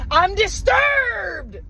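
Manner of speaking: high, slow, shouted mock outrage; mock dramatic outcry. Telling the park story, hammed-up dramatic outburst. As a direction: wail it like a melodrama.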